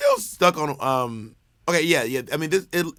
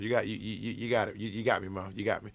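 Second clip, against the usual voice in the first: much softer, low-pitched admission; quiet concession; 7 semitones lower, 11 dB quieter, faster than usual. Real, not acted. Podcaster admits co-host caught them, quiet concession. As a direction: concede under your breath, low and soft.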